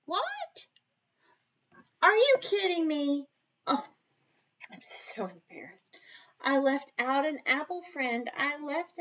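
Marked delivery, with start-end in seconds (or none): 0.0-3.8 mock surprise. Teacher feigns shock at miscount. 4.7-5.8 mock embarrassed whisper. Teacher pretends embarrassment.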